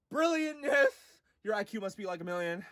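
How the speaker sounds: high but very soft awed praise; breathless God after the shout. Acted. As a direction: gush quietly. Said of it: "And God's like".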